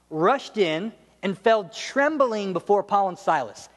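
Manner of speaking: ordinary talk, nothing marked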